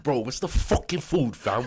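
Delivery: mock South London roadman; lightly imitating a London roadman; roadman accent. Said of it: "my road man accent".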